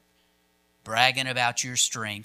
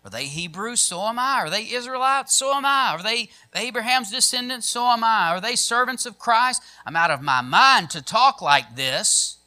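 high-pitched rhetorical question and answer; mildly hammed-up scriptural bragging; boastful fool; 12 semitones higher, 8 dB louder than usual. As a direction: rattle off the credentials like a boast. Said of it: "This is the way a fool talks".

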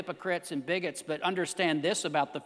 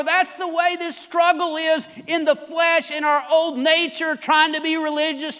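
high, loud impassioned preacher; full-force preaching; 9 semitones higher, 8 dB louder than usual. Real, not acted. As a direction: proclaim the answer to the congregation.

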